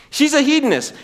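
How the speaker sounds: usual speaking voice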